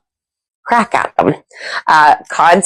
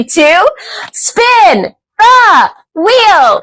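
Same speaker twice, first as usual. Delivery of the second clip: high, very slow, drawn-out call; game-show host; 11 semitones higher, much slower than usual. Acted. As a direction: draw out "spin the wheel" like a show host.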